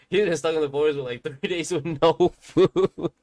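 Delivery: words breaking into loud laughter; laughing. Real, not acted. Telling viewers the forest story, real loud laughter. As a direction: laugh through it, can't hold it in. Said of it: "I'm sorry I'm laughing about this".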